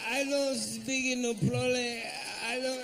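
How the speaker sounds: strained, slow broken English; mock broken English. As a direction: squeeze it out like straining. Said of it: "a sound coming out of their face".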